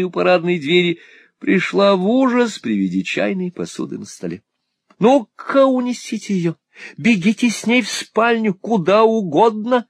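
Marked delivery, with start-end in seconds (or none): 5.0-9.9 high, loud, flustered Miss La Creevy; a little lady in a panic over the teacups; flustered exclaiming. A narrator's reading. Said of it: "воскликнула мисс Лакриви". (взволнованно восклицает высоким голосом)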